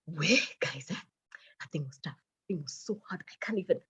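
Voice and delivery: breathy, overwhelmed whisper; drained confiding whisper. Retold or imitated. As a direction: whisper it as if the act just dropped. Acts out collapse after faked interview confidence.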